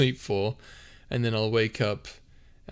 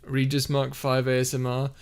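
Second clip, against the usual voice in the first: ordinary talk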